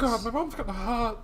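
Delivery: high, drawn-out put-on boy's whine; hammed-up anguished squeal; mock teen wail. Joking as Shinji hiding in his room, mock teen wail. Listener voices the boy hiding.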